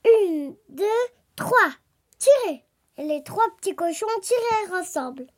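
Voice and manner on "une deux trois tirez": louder count, rising to a higher command; shouted count. A narrator's reading. Pig leading his brothers to pull the tail, calling out. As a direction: count them in and call the pull. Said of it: "cria-t-il à ses frères".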